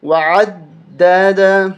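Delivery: وعددا with the دال pressed hard; doubled dal pressed. Shown as a demonstration. Teacher shows the tashdid in وعددا. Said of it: "اس کو دبا کے اس پہ سختی کریں گے".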